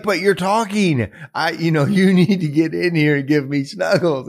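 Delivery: put-on high-pitched kid protest; insistent little boy. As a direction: imitate a small child demanding attention. Boy insists on snuggles from mother.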